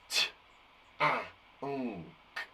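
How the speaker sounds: stamp out each sound for the class; sounded out letter by letter. Shown as a demonstration. Teacher sounding out tank for pupils. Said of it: "We're gonna stamp on those sounds".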